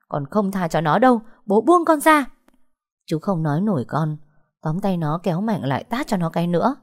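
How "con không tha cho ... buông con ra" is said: angry yell, climbing high and loud; defiant yell. Acted. Daughter defies father holding her back, defiant yell. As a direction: build to a high, loud demand.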